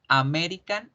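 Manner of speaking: "American" with full Spanish vowels; Spanish-style pronunciation. Shown as a demonstration. Teacher shows students the Spanish reading, Spanish-style pronunciation. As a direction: say "American" as spelt in Spanish, clear A at both ends. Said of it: "en español lo pronunciaría".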